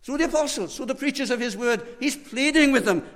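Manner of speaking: high, pressing pulpit emphasis; urgent preacher's appeal; fervent preaching. Acted. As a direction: lean hard on pleading. Preacher stressing pleading.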